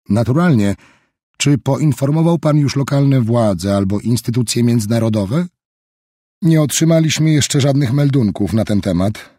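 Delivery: smooth veiled pressure; Roberts voiced. A narrator's reading.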